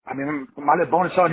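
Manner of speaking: ordinary talk, nothing marked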